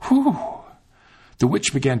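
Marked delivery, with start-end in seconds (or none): 0.0-0.4 high loud whew of relief; relieved whew. A narrator's reading. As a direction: breathe out the danger. Relief after shutting oven.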